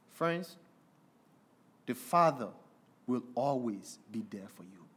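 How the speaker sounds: soft, low, earnest sermon appeal; tender preaching. Acted. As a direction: speak it gently to the congregation.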